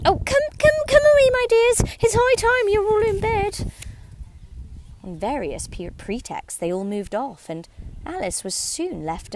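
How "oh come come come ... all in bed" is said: very high, louder trembling bird voice; a tiny bird fluttering its chicks home; trembling canary. A narrator's reading. Canary calling its children to bed, very high trembling voice. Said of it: "in a trembling voice".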